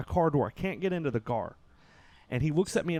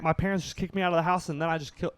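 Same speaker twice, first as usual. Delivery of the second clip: usual speaking voice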